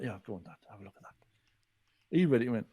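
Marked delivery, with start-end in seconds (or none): 0.0-1.1 hushed aside. Retold or imitated. Shyly handing book to dad.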